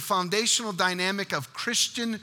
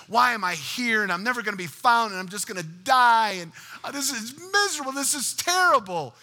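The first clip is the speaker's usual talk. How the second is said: building, higher wail of mock despair; crying out in complaint; 5 semitones higher, 3 dB louder than usual. Shipwreck survivor protesting to God, crying out in despair. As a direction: bemoan it, climbing in pitch toward the end. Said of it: "he was crying out to God".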